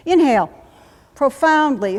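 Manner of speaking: loud, emphatic cue; breathing command. Shown as a demonstration. Instructor leads box breathing, loud command. Coaching a breath drill aloud.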